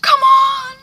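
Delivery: very high, loud calls; urgent coaxing. Retold or imitated. Catherine hurries Hannah to clean up, urgent coaxing. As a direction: escalate each urging into a cry.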